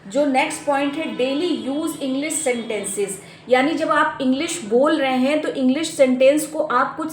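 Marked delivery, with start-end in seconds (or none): 1.2-3.1 heading. Shown as a demonstration. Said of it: "lesson heading".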